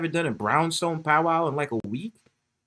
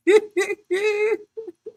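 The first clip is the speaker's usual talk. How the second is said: high giggle. Real, not acted. Laughs after the falsetto greeting.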